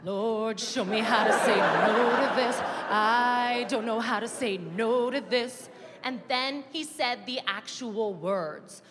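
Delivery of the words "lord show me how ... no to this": the actor's Hamilton begging God for help resisting; tempted prayer, louder. Retold or imitated. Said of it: "what are you gonna say?".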